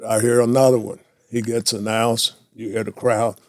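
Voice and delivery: usual speaking voice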